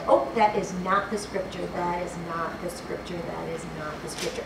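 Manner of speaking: low, soft talking to yourself; muted self-correction. Real, not acted. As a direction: fade away as you realise the mistake. Preacher catches own wrong verse, to self.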